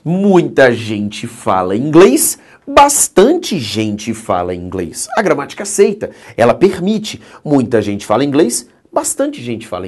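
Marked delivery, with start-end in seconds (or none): 0.0-2.4 slow, careful model sentence; slow demo. Shown as a demonstration. Teacher modelling the original sentence for students, slow. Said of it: "Por bastante". 2.7-5.1 bastante slow and lower, leaned on; stress on bastante. Shown as a demonstration. Teacher showing the swapped word bastante to students, stressed. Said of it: "Por bastante".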